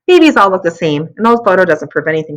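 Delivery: plain reading voice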